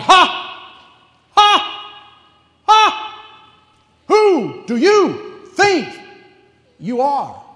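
loud, high mocking ha, ha; scornful laugh. God scoffing at rebellious humanity, loud high mocking laughter. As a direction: laugh down at puny man. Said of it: "it's more like".

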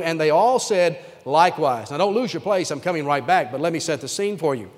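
ordinary talk, nothing marked

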